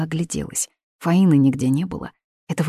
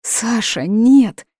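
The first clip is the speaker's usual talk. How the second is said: high, loud anguished cry; waking from a nightmare, screaming no; 6 semitones higher, 6 dB louder, much slower than usual. Acted. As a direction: cry out his name in horror. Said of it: "закричала Мила".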